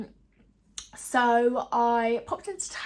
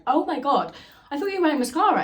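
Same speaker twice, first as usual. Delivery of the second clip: excited disbelief; 7 semitones higher, 9 dB louder, faster than usual. Retold or imitated. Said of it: "I was like".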